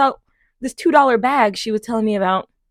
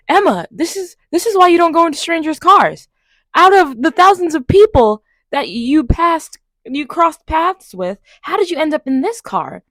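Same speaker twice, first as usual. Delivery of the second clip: higher, louder, exasperated self-talk; self-scolding; 7 semitones higher, 5 dB louder than usual. Retold or imitated. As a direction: scold yourself in frustrated disbelief. Scolding herself in thought.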